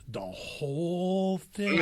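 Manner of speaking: drawn-out, amused disbelief, laughing; slow incredulous question. Retold or imitated. Masseuse doubting the request, slow incredulous question. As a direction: stretch it out like you can't believe it. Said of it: "She goes".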